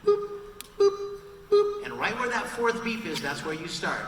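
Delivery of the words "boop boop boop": short high boops, evenly spaced; imitated cue beeps. Shown as a demonstration. Voice actor giving a fan recording cues, imitated beeps. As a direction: mimic a studio countdown beep. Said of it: "the same beeps that we get".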